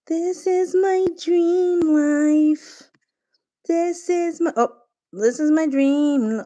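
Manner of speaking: slow, high, loud yearning; a slow, high, swooning "this is my dream"; dreamy longing. Real, not acted. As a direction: swoon over your dream life. Dreamy gushing.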